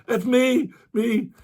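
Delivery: high, eager "that's me"; eager reply. Retold or imitated. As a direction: jump at your name being called.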